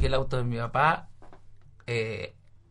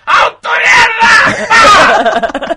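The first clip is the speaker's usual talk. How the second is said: much higher, louder, drawn-out outraged curse; furious shout; 14 dB louder, much slower than usual. Retold or imitated. Imitating his father discovering the dented car, furious shout. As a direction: roar like a father finding his car wrecked. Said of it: "estaba tan enojado".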